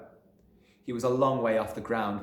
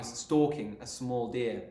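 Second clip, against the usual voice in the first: ordinary delivery, nothing marked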